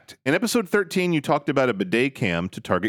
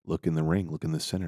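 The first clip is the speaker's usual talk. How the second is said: low and much softer; low mock husband; 10 semitones lower, 7 dB quieter than usual. Husband telling his wife to look in the ring, low. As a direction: murmur it deep.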